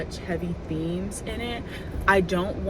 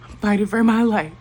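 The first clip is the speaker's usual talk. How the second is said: loud; 3 semitones higher, 12 dB louder than usual. Real, not acted.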